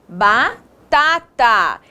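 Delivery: slow, split after the first syllable. Shown as a demonstration. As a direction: break the word up for young learners. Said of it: "separamos essas palavras em sílabas".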